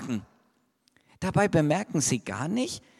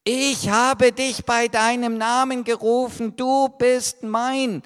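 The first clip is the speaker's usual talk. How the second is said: louder, earnest proclamation; solemn promise; 7 semitones higher, 5 dB louder than usual. Acted. Preacher quotes God's promise to each child, solemnly. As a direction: proclaim it, slowing at the end.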